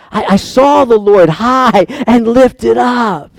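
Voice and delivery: slow, loud, lifted proclamation; ringing exclamation of wonder; awed cry. Real, not acted. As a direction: cry it out in awe. Preacher cries out in awe.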